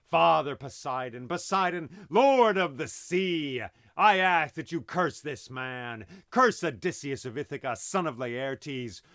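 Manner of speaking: blinded Cyclops crying out to his father Poseidon; loud curse. Acted. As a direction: call down the sea god's wrath. Cyclops curses, arms raised to sky.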